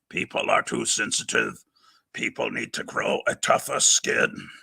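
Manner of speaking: low, rough, barely voiced villain impression; badly imitated movie villain voice; Doctor Phibes. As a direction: drop low and half-voiced, an amateur horror-villain impersonation. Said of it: "I can't do a good Doctor Phibes voice".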